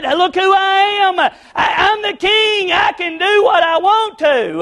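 boastful king, high and drawn out; David/character. Acted. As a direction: proclaim it high and slow like a king above the rules. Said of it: "Because he said".

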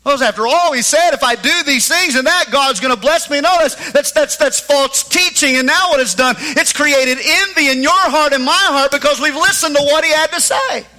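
loud, high fiery preaching; preaching at full force. Acted.

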